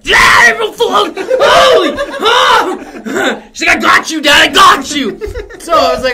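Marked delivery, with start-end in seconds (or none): 0.0-3.4 high, loud laughing yell of triumph; gleeful shout. Retold or imitated. Daughter crowing to dad after scaring him, high laughing shout. 3.8-5.2 high, loud taunting cheer; gleeful shout. Retold or imitated. Daughter taunting dad after the prank, loud gleeful shout.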